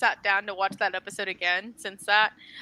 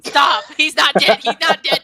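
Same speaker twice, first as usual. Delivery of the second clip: louder, higher, stressing not; insistent protest; 5 semitones higher, 9 dB louder than usual. Real, not acted. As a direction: refuse to accept he's dead. Insisting the character isn't dead.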